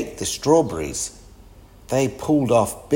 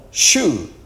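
loud, higher-pitched shooing; yelled; 5 semitones higher, 6 dB louder than usual. A narrator's reading. Everyone chases the cat away, yelling. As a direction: yell to chase an animal off. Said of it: "everyone yelled".

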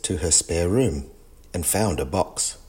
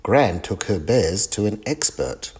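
ordinary delivery

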